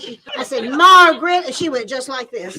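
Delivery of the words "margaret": loud call, rising at the end; sharp call. Retold or imitated. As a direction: call her name sharply. Finds her hiding behind boxes.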